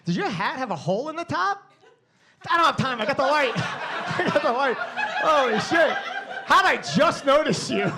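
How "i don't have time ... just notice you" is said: high, loud talk through laughter; the comic losing it over a hat; laughing. Real, not acted. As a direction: crack up mid-sentence. Noticing hat hole, cracking up.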